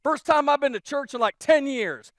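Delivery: blurting out happy praise; excited visitor. Retold or imitated. Newcomer gushing to the pastor after church, loud and excited. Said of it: "He said".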